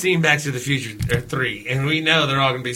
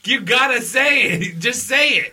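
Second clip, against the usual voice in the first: excited urging; 9 semitones higher than usual. Real, not acted.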